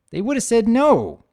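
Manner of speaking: high, loud filly voice, matter-of-fact; cheeky filly. Acted. Filly explaining her logic to Spike, high and blunt. Firefly continues.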